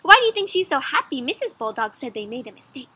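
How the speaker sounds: plain reading voice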